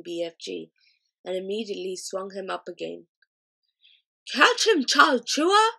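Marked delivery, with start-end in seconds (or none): 4.3-5.7 high, loud game-play shout; giant shouting. A narrator's reading. Flesh Lump Eater tossing the BFG, loud high shout. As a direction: fling the call across the field. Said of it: "he shouted".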